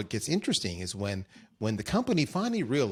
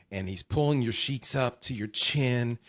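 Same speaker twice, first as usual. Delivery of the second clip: normal talking voice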